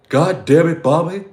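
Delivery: angry dad yelling at his son, hammed-up; Hank Hill yelling. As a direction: holler at Bobby in dad voice. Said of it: "King of the Hill".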